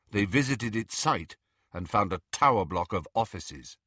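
ordinary delivery, nothing marked

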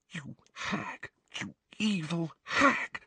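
furious whisper. A narrator's reading. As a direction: whisper the insult through held-back fury. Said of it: "she whispered".

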